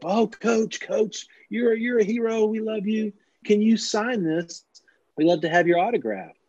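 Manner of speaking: high-pitched starstruck fan voice; gushing fans. Acted. Fans rushing up to a coach for an autograph, gushing. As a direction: gush like excited autograph seekers. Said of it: "some fans walked up".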